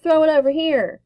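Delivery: loud, high yell across the yard. Acted. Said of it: "her brother yells".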